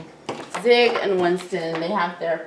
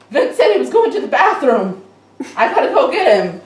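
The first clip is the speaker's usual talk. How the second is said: alarmed outburst; 8 semitones higher, 8 dB louder, faster than usual. Retold or imitated. Tiny alarmed, heading off.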